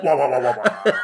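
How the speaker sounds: mock wah wah horn; sad trombone. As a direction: sing the fail trombone.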